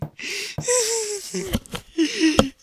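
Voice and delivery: high-pitched mock-crazed killer laugh; high maniacal giggle. Killer chasing a victim, high maniacal giggle.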